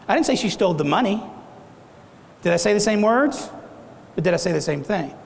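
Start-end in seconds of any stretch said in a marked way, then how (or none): 0.1-1.2 "money" stressed at the end with a lift. Shown as a demonstration. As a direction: save the punch for the last word "money". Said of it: "say that several different ways".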